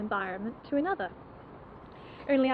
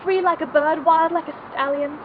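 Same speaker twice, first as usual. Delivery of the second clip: loud, raised, emotional outpouring; impassioned confession; 3 semitones higher, 5 dB louder than usual. Acted. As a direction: confess it fervently, voice lifted. Impassioned confession of jealousy and admiration.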